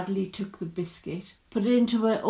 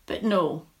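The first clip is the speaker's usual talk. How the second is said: usual speaking voice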